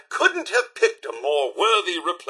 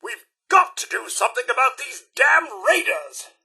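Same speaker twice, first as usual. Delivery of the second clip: higher-pitched, emphatic indignation; exasperated outburst; 6 semitones higher than usual. Acted. Butler fed up with the damn raiders, exasperated. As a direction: fume about it, pitch lifted.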